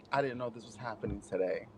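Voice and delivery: soft flat mock of his intro; imitating him. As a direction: echo him deadpan. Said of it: "when you verbally speak".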